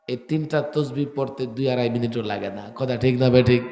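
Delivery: normal talking voice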